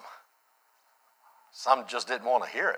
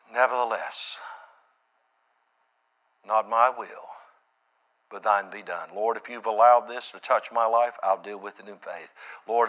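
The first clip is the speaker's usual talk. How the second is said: low, subdued praying voice; earnest prayer; 3 semitones lower than usual. Real, not acted. Prayer within the sermon.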